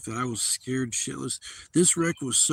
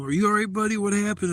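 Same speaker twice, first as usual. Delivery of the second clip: concerned bystander; 7 semitones higher, 4 dB louder than usual. Retold or imitated. Bystanders rushing to wrecked car.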